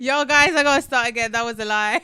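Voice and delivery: loud, bursting with laughter; can't hold it together, laughing. Real, not acted. Breaks out laughing, restarts story.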